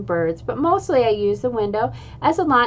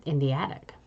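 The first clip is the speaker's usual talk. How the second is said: "In the attic" dropped low and soft, rising at the end; hushed echo; 7 semitones lower, 8 dB quieter than usual. A narrator's reading. Boy tempted by the off-limits attic, hushed. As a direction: murmur "In the attic" like a forbidden idea.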